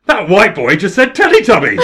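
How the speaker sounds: high, loud incredulous outburst with laughter; shouting. Retold or imitated. Woman MP reacting in Parliament, mock shouting. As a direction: imitate a scandalised MP yelling, laugh bubbling under. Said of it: "just shouted".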